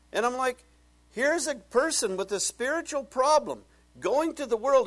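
high, loud impassioned preaching; full-force preaching. Real, not acted. Preacher railing against psychology to congregation, loud and high. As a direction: build up and drive it home.

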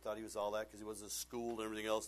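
ordinary talk, nothing marked

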